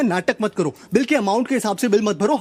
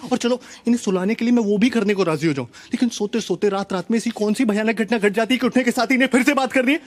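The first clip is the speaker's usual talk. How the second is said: ordinary delivery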